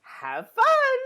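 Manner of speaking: high, loud hammed-up sweet schoolgirl; mock Claire. Mock Claire leaving the two alone, hammed-up and sugary. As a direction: play her as sugary matchmaker, then flounce off. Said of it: "they're walking around like".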